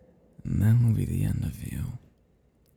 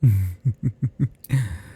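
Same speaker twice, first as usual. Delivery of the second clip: dark, delighted vampire laugh; sinister chuckle; 3 dB louder than usual. Acted. Vampire imagines the chase, sinister chuckle. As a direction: chuckle at the thought of prey.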